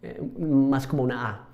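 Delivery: ordinary delivery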